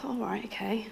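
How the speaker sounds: whisper. Retold or imitated. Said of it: "I was like".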